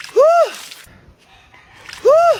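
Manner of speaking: high, loud whooping; excited whoop. Acted. Girl relishes a cold bath, whooping loudly. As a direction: whoop with delight.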